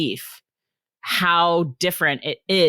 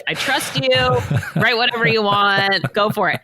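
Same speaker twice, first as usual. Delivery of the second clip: a lightly raised, breezy husband voice; husband's easy trust; 6 semitones higher, 4 dB louder than usual. Retold or imitated. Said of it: "He's like".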